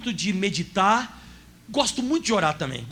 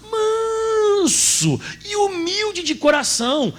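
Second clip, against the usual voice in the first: voz embargada, bem mais aguda e muito lenta; tearful scripture; 13 semitones higher, much slower than usual. Real, not acted. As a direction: chorando de emoção ao ler. Preacher moved while quoting scripture. (pregador emocionado lê a Escritura, com a voz embargada)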